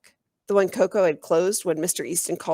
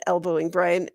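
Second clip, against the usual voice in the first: usual speaking voice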